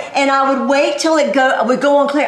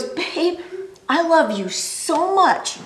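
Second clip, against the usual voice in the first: husband's confession; 4 semitones higher, slower than usual. Retold or imitated. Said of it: "he goes".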